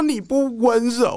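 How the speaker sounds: put-on high, slow crying-child whine; whiny child voice. Children wailing at the teacher, high whiny child voice. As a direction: pout it out like a wailing kid. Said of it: "然后他们就说".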